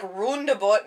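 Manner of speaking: Canadian accent, "Roundabout" with a round oo-like vowel. Shown as a demonstration. Canadian accent demo of "Roundabout" for friends. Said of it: "emphasizing it now so I don't say it weird".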